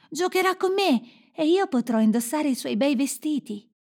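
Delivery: put-on high little-girl voice; bandit's daughter. A narrator's reading. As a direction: pipe up like an eager child. Bandit's young daughter demands Gerda.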